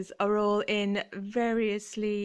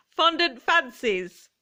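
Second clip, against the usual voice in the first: loud, drawn-out, laughing exclamation; retold excited cry; 6 semitones higher, 8 dB louder, slower than usual. Customer walking back into shop with an idea, excited cry. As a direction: burst out with it, high and slow.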